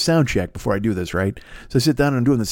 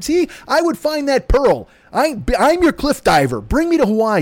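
much higher, slower grand bragging; mock heroic boast; 12 semitones higher than usual. To the listeners, demanding a Hawaii trip: hammed-up boast. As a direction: puff up like a proud cliff diver.

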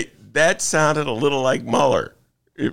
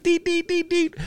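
much higher, chirpy electronic deet deet; phone alert beep. Shown as a demonstration. Host mimicking his phone alert mid-rant, high beeps. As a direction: imitate the phone's notification beep. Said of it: "update from my phone".

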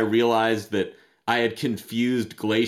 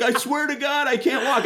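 whiny friend; 13 semitones higher than usual. Retold or imitated. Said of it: "And he's like".

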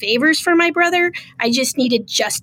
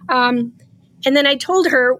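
normal talking voice

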